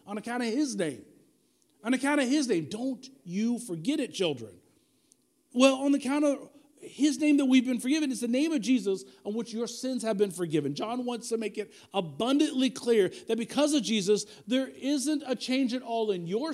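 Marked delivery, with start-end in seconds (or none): none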